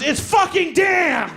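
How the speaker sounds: very high, slow yell; exasperated shout. Retold or imitated. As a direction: act out a friend yelling back in an argument. Acted shouting argument, friend insists.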